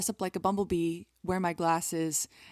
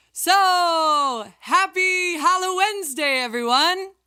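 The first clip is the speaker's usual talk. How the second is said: excited spooky greeting; 11 semitones higher, 9 dB louder, slower than usual. Acted.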